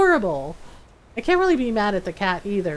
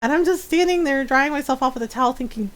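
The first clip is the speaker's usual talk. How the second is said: ordinary talk, nothing marked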